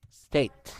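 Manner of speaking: "State" said alone with crisp S and final T; "State" as one careful word, STAYT; pronunciation model. Shown as a demonstration. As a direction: model "State" for a learner, every sound clear. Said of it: "the next word is state".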